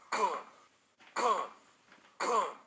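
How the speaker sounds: three loud, high booms, evenly spaced; booming knock sound effect by voice; imitated door banging. A narrator's reading. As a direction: punch each boom like a fist on a door.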